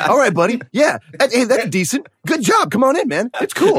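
high, upbeat encouraging dude; mock Tom Cruise. Friend imitates Maverick welcoming men, high and upbeat. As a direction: cheer on each newcomer like a friendly coach. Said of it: "He's just like".

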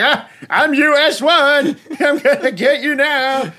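high, slow, dopey voice with a laugh in it; drawn out like a man with a hole in his head; dazed US One. Retold or imitated.

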